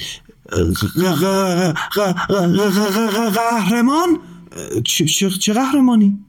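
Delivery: put-on high, stammering boy asking; boy stuttering at the strange word; puzzled stutter. A narrator's reading. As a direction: stumble over the word in confusion. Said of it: "پسر پرسید".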